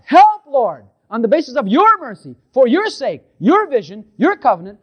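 high, loud pleading cry; crying out to God. Retold or imitated. Believer crying out to God for help, loud pleading. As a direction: beg the Lord with full voice. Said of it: "You cried out to God".

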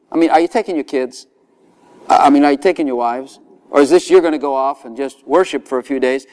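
ordinary delivery

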